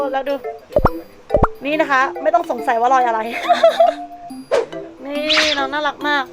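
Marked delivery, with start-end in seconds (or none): 3.2-5.0 squealing laugh, pitched way up; high squeal of laughter. Real, not acted. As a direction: burst into a high giggle. Laughing at hamster.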